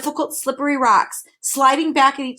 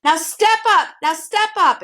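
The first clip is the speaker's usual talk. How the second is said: calling out; 8 semitones higher, 6 dB louder than usual. A narrator's reading. Hidden voice guiding the boys, calling out. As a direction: call them over from a distance. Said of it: "it recommended".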